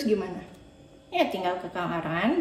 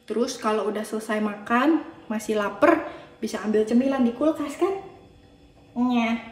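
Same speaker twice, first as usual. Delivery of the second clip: ordinary talk, nothing marked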